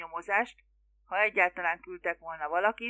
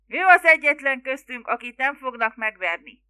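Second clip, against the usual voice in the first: loud, raised retort easing off; irritated yell; 4 semitones higher, 11 dB louder than usual. A narrator's reading. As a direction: shout back in exasperation. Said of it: "ordította vissza Larry". (ingerült, hangos visszakiabálás)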